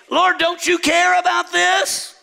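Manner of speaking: high, drawn-out protest; pleading complaint. Acted. A tempted believer questions the Lord, pleading in complaint. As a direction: wail the question slowly upward.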